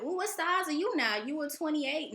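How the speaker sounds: high, gossipy relative asking sizes; mock nosy relative. Mimicking a relative quizzing someone's dress size, gossipy. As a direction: tease like a prying aunt.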